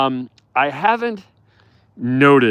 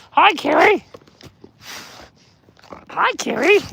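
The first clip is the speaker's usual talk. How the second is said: much higher, louder sing-song hello; 6 dB louder than usual. Real, not acted. Owner greeting a wolf in baby talk. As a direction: chirp hello up high.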